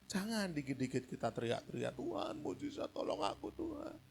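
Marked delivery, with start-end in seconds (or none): 2.0-4.0 unvoiced, breathy begging whisper; mock whimpering plea. Mocking childish crying prayer. (bisikan memohon yang mengejek)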